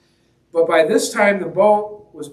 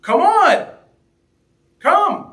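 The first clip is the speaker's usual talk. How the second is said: Jesus calling out; 13 semitones higher, 5 dB louder, much slower than usual. Retold or imitated. Jesus calling Peter onto water.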